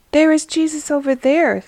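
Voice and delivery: shepherd excitedly showing Jesus; excited pointing. Acted. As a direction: point him out with delight.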